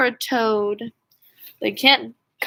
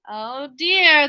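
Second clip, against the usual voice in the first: ordinary delivery, nothing marked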